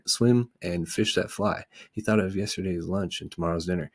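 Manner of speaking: plain reading voice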